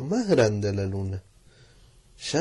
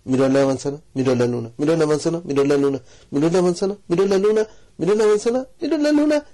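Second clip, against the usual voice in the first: building from louder to far higher; rising to excitement; 6 semitones higher, 6 dB louder, faster than usual. A narrator's reading. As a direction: build the tension climbing up. Narrator builds tension.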